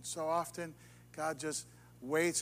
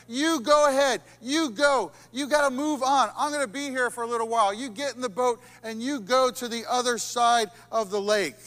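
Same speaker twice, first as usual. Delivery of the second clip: loud, insistent Jesus sending them off; Jesus urging; 6 semitones higher, 7 dB louder than usual. Acted. Jesus ordering the disciples into the boat, insistent. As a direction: push them out the door, firm and loud.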